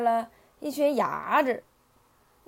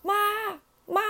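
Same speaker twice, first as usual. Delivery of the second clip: short, high tadpole calls; calling for mum; 11 semitones higher than usual. A narrator's reading. Tadpoles hailing the old turtle, high calls. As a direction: call "ma" in a little high voice. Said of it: "他们就上去喊".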